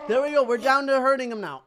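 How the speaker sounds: loud, high, excited call; triumphant. Real, not acted. Gamer past the opponent's armor, triumphant shout. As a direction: cheer the breakthrough. Cheers breaking through armor.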